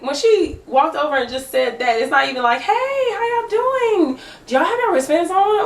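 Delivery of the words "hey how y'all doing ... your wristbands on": high, slow, sugary pretend greeting; mock polite greeting. How she should have greeted them, high and sugary. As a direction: sing it out sweetly, mock-friendly.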